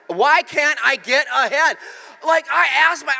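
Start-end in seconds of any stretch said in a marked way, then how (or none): 0.1-1.8 louder and slower, drawn out; slow called-out question. Shown as a demonstration. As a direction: ask it out loud to your neighbor. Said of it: "Ask him".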